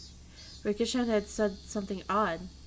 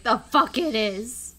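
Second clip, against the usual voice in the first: loud, drawn-out retold comeback; exasperated outburst, louder and slower; indignant retort; 7 dB louder, slower than usual. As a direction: stretch it out in loud disbelief. Retells the same comeback again.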